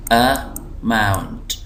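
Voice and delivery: Amount very slow, in two parts; syllable by syllable. Shown as a demonstration. Teacher showing the two syllables, slow split word. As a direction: split amount into अ, pause, mount. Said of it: "जो दो syllable हैं".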